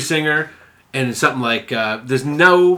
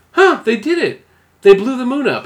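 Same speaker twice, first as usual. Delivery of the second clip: much higher, louder mock wonder; imitated astonishment; 12 semitones higher, 5 dB louder than usual. Scientist seeing the moon blown up, mock astonished. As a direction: mimic a sketch character marvelling.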